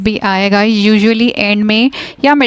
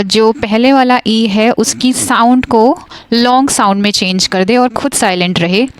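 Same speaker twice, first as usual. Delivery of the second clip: ordinary delivery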